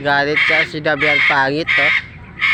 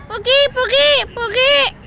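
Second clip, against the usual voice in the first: very high squeaky coaxing call; high pet-talk voice; high bird-talk. Real, not acted. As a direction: sing it up high to coax a bird. Praising the parrot.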